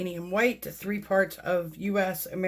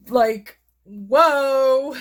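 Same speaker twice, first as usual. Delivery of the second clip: louder, amazed; amazed whoa; 5 semitones higher, 9 dB louder than usual. Real, not acted. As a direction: gasp it out. Reacting to the cells.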